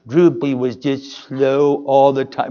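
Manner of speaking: slow, low and droopy, a light Droopy imitation; Droopy drawl. Preacher lightly mimics Droopy for the congregation, slow and low. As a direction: drag it out like a mopey cartoon dog.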